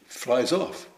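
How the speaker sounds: usual speaking voice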